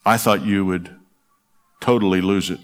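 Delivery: gentle recital of a poem; poem reading. Acted. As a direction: read it like a love letter to a lost husband. Reads a poem aloud.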